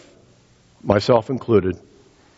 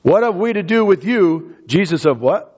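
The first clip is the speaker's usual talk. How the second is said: demon crying out; 4 semitones higher than usual. Acted. Preacher voices the demon crying out.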